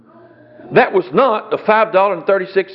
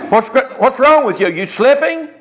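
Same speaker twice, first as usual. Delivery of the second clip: higher, laughing mock wife; mock scolding wife; 7 semitones higher than usual. Wife mocking her husband's bad deal, high and laughing. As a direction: tease him as his amused wife. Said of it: "she said".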